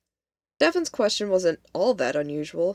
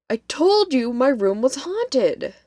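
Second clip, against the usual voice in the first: high, loud, slow exasperated protest; upset insistence; 7 semitones higher, 5 dB louder than usual. A narrator's reading. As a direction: insist indignantly, dragging out the words. Upset friend insists after being laughed at.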